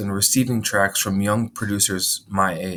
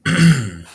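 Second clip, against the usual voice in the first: throat clearing; 5 semitones higher, 7 dB louder than usual. Real, not acted. Another throat clearing after pause.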